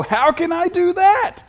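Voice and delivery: very high, straining mock question; mock exasperated. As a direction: act out a bewildered listener's high-pitched protest. Voices listener's bewildered question.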